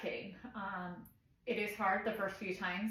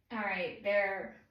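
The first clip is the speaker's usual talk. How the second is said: ordinary talk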